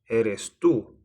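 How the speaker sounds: tú set apart and lifted; stress on tú. Shown as a demonstration. Teacher repeating the emphatic pronoun, stress on tú. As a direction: put the weight on tú. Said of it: "So it's to emphasize".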